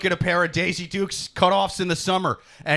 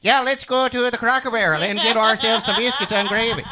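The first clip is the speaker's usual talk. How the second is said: silly put-on Norwegian accent, laughing through it; over-the-top fake Norwegian accent; 3 semitones higher than usual. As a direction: play a cartoon Norwegian ordering at Cracker Barrel. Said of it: "Just start having a Norwegian accent".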